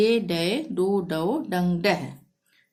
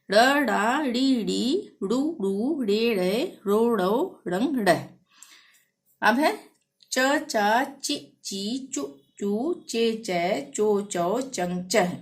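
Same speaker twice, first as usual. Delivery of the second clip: barakhadi recitation; much slower than usual. Acted. Teacher reciting the syllable chart for students to repeat. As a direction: recite each pair evenly like a drill.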